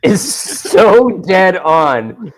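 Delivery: amused outburst, words riding the laugh. Real, not acted. Laughing about the Kents' phone yelling.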